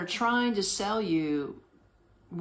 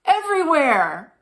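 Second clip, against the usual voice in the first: cheeky dodge; 7 semitones higher, 9 dB louder than usual. Retold or imitated. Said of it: "was like".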